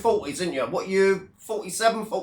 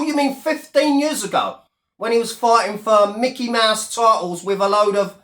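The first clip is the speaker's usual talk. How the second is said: ordinary delivery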